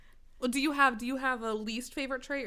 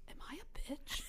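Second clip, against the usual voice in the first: very soft, imitated worried question; insecure whisper; 4 semitones higher, 14 dB quieter than usual. Imitating friend who comes up worried, insecure whisper. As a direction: whisper it as a timid confession.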